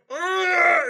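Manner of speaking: loud, high cry of pain. Retold or imitated. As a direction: yelp as the scalp swells. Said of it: "And you're like".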